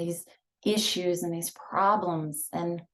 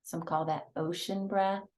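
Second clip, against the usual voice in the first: guided meditation; 3 dB quieter than usual. Acted. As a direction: ease them into each breath, hushed. Leads breathing meditation.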